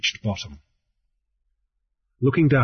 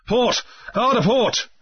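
much higher, urgent helm order; cried order; 11 semitones higher than usual. A narrator's reading. Grief cries to the helmsman to steer for the rock, urgent. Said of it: "Grief cried".

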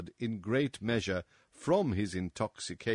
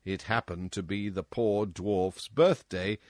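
ordinary delivery, nothing marked

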